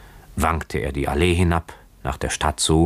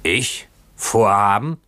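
higher, half-restrained indignant shout; mock yell; 4 semitones higher, 3 dB louder, slower than usual. A narrator's reading. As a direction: throw it back as a narrated yell. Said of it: "schrie der Bootsmann". (gespielter, leicht gedämpfter Schrei)

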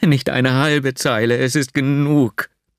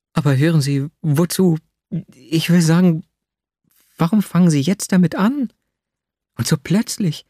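put-on higher, startled young woman; Nastjenka flustered; 6 semitones higher than usual. A narrator's reading. Nastjenka asking why he confesses so suddenly, higher and flustered. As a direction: caught off guard by his confession.

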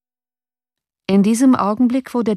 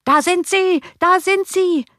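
shouting crowd; 13 semitones higher than usual. A narrator's reading. Five hundred voices shouting at the coach. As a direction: roar it as the whole mob. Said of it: "riefen gleichzeitig fünfhundert Stimmen".

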